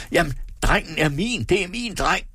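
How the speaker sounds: high, stamping tantrum; acted cry of possessive outrage; angry scream. A narrator's reading. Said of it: "skreg han og stampede arigt".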